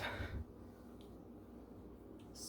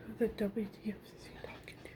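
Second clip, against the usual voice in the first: whispered mom quote; 7 dB quieter, faster than usual. Retold or imitated. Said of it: "So my mom's like".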